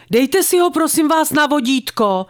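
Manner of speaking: urgent plea called across the park. A narrator's reading. Dog owner to the lady with a loose wolfdog, calling out. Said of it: "křikla jsem na dámu".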